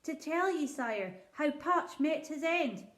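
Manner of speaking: a poor shepherd pleading before his king; rustic old shepherd. Acted.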